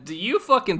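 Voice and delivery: ordinary talk, nothing marked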